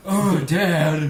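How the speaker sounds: mock embarrassed kid. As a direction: whine it like a kid mortified by dad.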